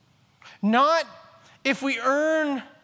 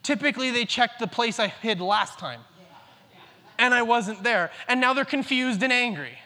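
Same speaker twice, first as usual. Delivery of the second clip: ordinary delivery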